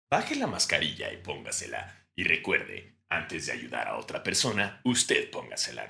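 mock calm flight attendant, low and soft; airline safety voice. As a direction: parody the serene safety video. Imitating airline safety video. (voz de video de seguridad, calmada)

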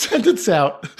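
ordinary delivery

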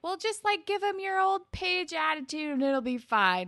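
high, loud mock pep talk; imitated pep talk. JT tries to fix Paige's mood, mock pep talk. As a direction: push the advice high and loud, imitating him. JT offers Paige cheery advice.

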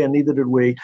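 ordinary talk, nothing marked

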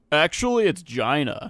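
GINA said as "JYE-nah", first vowel rhyming with eye; film-quote correction, GINA pronounced "jye-nah"; corrected pronunciation. Retold or imitated. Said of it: "It's like on forty-year-old Virgin".

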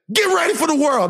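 high, hammed-up scolding dad; mock a dad toughening up his child; toxic dad. Dad to crying son, mock tough love. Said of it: "This is what my dad would say".

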